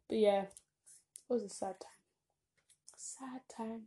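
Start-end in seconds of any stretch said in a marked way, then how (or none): 3.0-3.8 whispered, wistful aside; hushed. Real, not acted. Looking back on a friend leaving, whispered sadly. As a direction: let it fade to a whisper. Repeats sad time, dropping to whisper.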